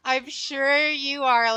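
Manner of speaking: drawn-out, loud, teasing; slow sarcastic. Real, not acted. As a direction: drag it out in disbelief. Teasing a friend's claim.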